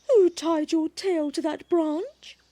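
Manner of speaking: high, slow mother cat voice; concerned mother cat. Acted. Mother bush cat questioning her stranded baby, concerned.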